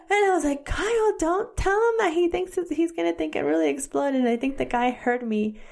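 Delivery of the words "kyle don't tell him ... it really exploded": high-pitched, exasperated protest; mock-alarmed scolding. Wife retelling how she stopped husband on phone, mock-alarmed. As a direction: stop your husband before he embarrasses you.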